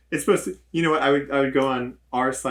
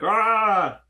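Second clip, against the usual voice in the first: high yelled battle cry; paladin shout; 12 semitones higher, 5 dB louder than usual. Pretend. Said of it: "They just go like".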